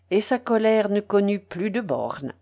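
ordinary delivery, nothing marked

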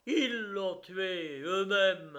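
slow, high, loud accusation; insistent. A narrator's reading. The protester accuses the gendarmes to all around, loud and insistent. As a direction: hammer it out, insisting. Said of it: "insistait Isvolgine".

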